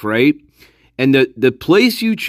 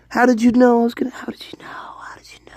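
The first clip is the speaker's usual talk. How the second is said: whispered surprise; 10 semitones higher, much faster than usual. Pretend. Mock psychic surprise.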